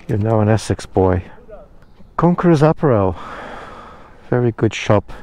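normal talking voice

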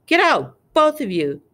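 high, loud order easing off; croaked order. A narrator's reading. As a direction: burst out, then settle on the last words. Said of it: "croaked Uncle Vernon".

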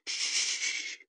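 whispered, almost sung shh; breathed hush. Acted. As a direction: soothe her with a soft breath. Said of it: "she breathed, sang it almost".

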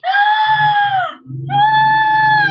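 loud, high delighted gasp; big gasp. Acted. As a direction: gasp out loud in mock amazement. Teacher reacts to child's surprise.

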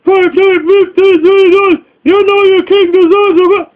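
high, whiny wheedling plea; a mocking high-pitched beggar voice; mock begging. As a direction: beg like a pitiful hustler. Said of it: "or begging".

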